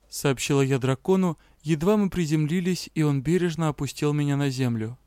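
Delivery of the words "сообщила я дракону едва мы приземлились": ordinary delivery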